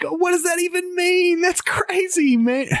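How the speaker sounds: very high mock-amazed voice; high-pitched, hammed-up wonder; amazed reaction. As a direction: squeal it in mock astonishment. Retelling a commercial's amazed reaction.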